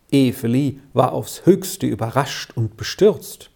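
usual speaking voice

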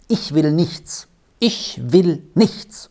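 slow, firm refusal repeated; vehement. Acted. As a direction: cut him off hard. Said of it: "unterbrach ihn Moni heftig". (heftig und langsam abwehrend)

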